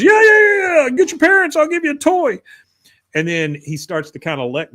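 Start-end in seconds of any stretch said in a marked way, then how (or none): 0.0-2.4 very high, loud pleading; desperate mock shout. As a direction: shout urgently as the stranded man, pitched way up. Bill desperately bargains with the girl.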